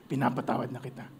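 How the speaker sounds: calm big-cat kindness; gentle lion. Acted. Lion gently telling the mouse it's fine, low and soft.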